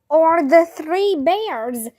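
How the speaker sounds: usual speaking voice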